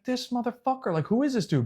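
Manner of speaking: high, hushed indignant disbelief; quiet, high-pitched mock outrage; retold incredulity. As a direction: replay it softly, as if under your breath. Retold indignant reaction to opponent.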